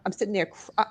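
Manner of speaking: usual speaking voice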